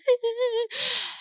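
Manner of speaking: high, shaky laugh-cry. Retold or imitated. Laugh-crying while telling a friend about the beach. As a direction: laugh that breaks into a sob.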